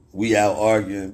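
normal talking voice

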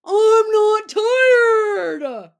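high, loud, slow whiny yell; tantrum shout. Acted. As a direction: stretch it out like a cranky toddler. Threw a tantrum.

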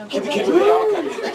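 squeaky voice through giggles; high giggly squeal. Real, not acted. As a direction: squeal it playfully. Giggly high squeal, then repeated.

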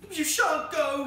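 very high, loud panicked protest; frantic wail. Acted. Vicar's wife clings to her husband, frantic high cry. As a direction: cry out shrieking to stop him.

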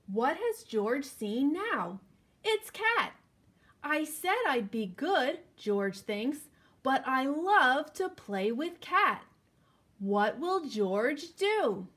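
put-on slow, lively storybook reading for kids; reading to children. A narrator's reading. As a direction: read it out like story time for little ones. Reading picture book to children.

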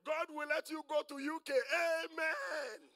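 mock a rousing blessing and amen; prosperity preacher parody.

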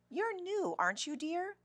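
put-on high, lilting grown-up addressing a child; sing-song high-pitched fussing over a newcomer; friendly school lady. A narrator's reading. Teacher greeting a new girl.

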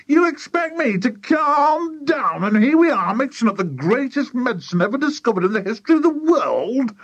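very high, frantic incredulous rant; indignant cry. A narrator's reading. Mister Cranky rants at his wife about the medicine, indignant cry. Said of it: "cried Mister Cranky".

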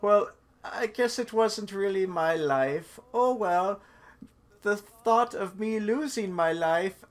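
high, loud, slow meme quote; PewDiePie meme. Retold or imitated. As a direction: draw it out in a loud, high put-on voice. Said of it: "remember that old meme where it's like".

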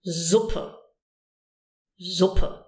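"Suppe" begun with a buzzing z; voiced S. Shown as a demonstration. Teacher giving an example of S before a vowel, voiced. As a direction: say "Suppe" as zuppe, voicing the S. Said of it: "using your voice".

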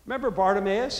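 usual speaking voice

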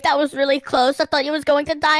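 ordinary talk

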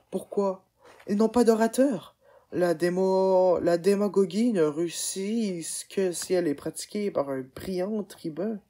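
emphatic. Acted. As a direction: press the argument hard. Adolphe argues a point.

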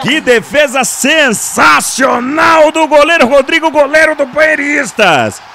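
slow, high shouted praise; excited commentary. Real, not acted. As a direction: hail the save at full voice. Commentator hails the save.

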